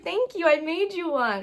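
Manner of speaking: high-pitched fan gushing in retelling; excited gushing. Retold or imitated. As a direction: gush it, acting out a thrilled thank-you. Retells excited exchange with idol.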